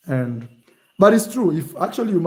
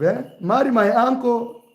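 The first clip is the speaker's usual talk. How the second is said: normal talking voice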